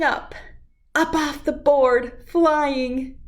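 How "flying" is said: usual speaking voice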